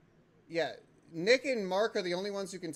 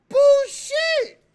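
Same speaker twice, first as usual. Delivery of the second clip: very high, loud bullshit; outraged yell; 18 dB louder than usual. Real, not acted. Protesting at Nick, outraged yell. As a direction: scream it in protest.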